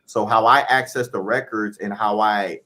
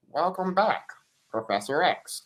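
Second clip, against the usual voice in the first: put-on voice, high on the greeting, dropping on the name; spirit guides' voice; 7 semitones higher, 4 dB quieter than usual. Guides greet him in the wheelchair, imitated spirit guides' voice. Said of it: "they said it this way".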